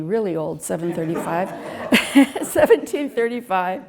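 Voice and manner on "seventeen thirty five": laughing at own slip, pitch lifting; chuckle, then restate it higher; amused self-correction. Real, not acted. Poet laughing at her misread farm date, amused.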